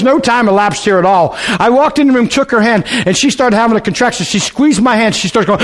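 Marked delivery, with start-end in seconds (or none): none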